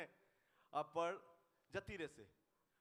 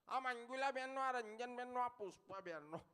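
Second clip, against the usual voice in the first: high, sing-song name-calling; mocking villagers; 6 semitones higher than usual. Village pointing out a husband by his wife's name, mocking. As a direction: call out each name in a teasing voice.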